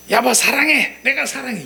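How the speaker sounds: high, loud "사랑해" called out; loud declaration. Shown as a demonstration. Pastor shows a loud wrong way to tell a spouse 사랑해. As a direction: blurt love loudly, the wrong way. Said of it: "이렇게 하겠습니까?".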